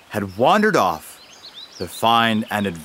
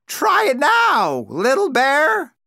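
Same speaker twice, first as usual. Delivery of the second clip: a much higher, louder crow call from the top; 14 semitones higher, 3 dB louder than usual. A narrator's reading. Crow calls Little Bear up from the rocks, a high, loud crow call. Crow's voice.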